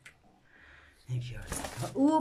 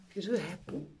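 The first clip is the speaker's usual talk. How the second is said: ordinary talk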